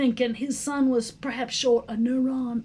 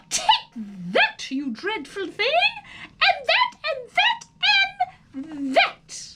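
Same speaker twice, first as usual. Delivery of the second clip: fierce battle cries; 13 semitones higher than usual. Acted. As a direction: strike and yell at the snake. Harriet beating the snake with stick.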